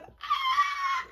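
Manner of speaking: high-pitched startled shriek; startled scream. Retold or imitated. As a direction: let out a sudden high yelp. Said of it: "I said".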